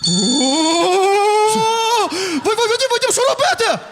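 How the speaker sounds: high, frantic cry for help; panicked wailing. Acted. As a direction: plead in a comic high-pitched panic. Panics, begs Vadim for help.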